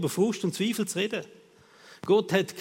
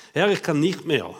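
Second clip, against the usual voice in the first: ordinary delivery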